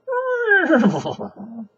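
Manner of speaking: a high whinnying neigh; shrill whinny of a cab horse. Acted. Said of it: "The horse neighs".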